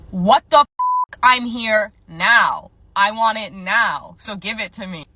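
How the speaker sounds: mock-furious demand, each point punched out; loud outburst, then slow, insistent demands; irate customer. Impatient customer demanding an online order from the cashier, irate.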